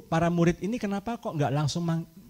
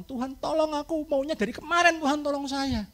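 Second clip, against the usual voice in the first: mock begging; 7 semitones higher, 5 dB quieter than usual. Mother begging for help.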